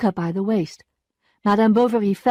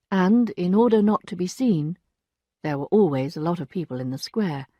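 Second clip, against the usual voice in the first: ordinary delivery, nothing marked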